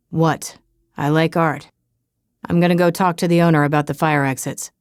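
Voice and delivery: low deadpan bodyguard; flat, deep and unbothered; deep monotone voice. Acted. Trevor the bodyguard speaks.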